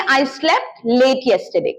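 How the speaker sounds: I slept late yesterday said very slowly; teacher's slow example; slow model sentence. Shown as a demonstration. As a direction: drag each word for the class. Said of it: "कोई words की जरूरत नहीं है".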